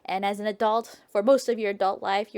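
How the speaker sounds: ordinary delivery, nothing marked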